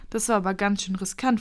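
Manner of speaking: usual speaking voice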